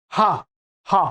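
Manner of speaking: हा breathed out from the throat, repeated; हा voiced deep in the throat; throat h demo. Shown as a demonstration. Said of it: "हा कंठ से बोला जाता है".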